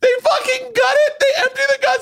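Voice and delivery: very high voice between laughing and crying; hysterical squealed retelling. Real, not acted. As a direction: squeal it out, completely overcome. Overcome while telling the gruesome story.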